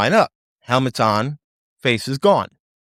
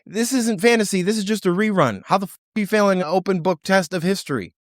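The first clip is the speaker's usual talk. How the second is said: mock exasperated show; 7 semitones higher than usual. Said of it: "is saying".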